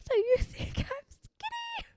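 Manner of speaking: very high, slow put-on squeak; mock voice. Joking after hearing she was skinny, high mock voice. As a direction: squeal it slowly in a silly falsetto.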